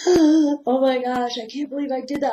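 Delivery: horrified at your own mistake; shocked inner voice. Pretend.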